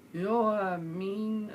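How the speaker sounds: slow, careful repeat of the phrase; patient slowed-down repeat. Shown as a demonstration. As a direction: spell it out slowly for him. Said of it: "so I had to slow down".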